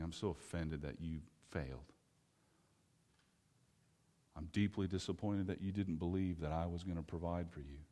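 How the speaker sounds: soft, low, cold disappointment; Jesus quietly disappointed in his disciples; cold offended Jesus. Retold or imitated. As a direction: arms crossed, quietly hurt. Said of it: "crossing his arms and saying".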